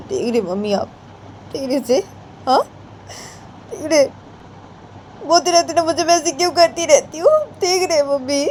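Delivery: put-on a mother tattling on her child to grandma; complaining mother.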